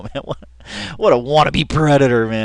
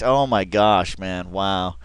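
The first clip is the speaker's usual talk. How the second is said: ordinary delivery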